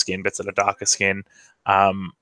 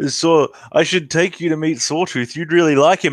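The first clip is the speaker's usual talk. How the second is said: eager Lug; 8 semitones higher, 4 dB louder than usual. Acted. Lug eagerly addresses Razor in character.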